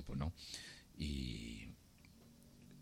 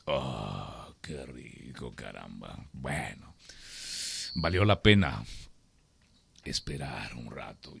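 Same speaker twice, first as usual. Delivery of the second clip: very low, soft, content Death; Death's voice; 13 semitones lower, 10 dB quieter, slower than usual. Acted. Death enjoys the farmer's coffee, very low and soft. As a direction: savour it, deep and quiet.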